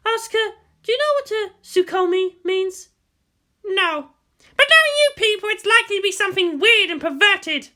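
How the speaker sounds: high, loud voiced women arguing; female voices argue. Guardian and girl argue over the commander, heated female voices. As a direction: play both women hot-tempered.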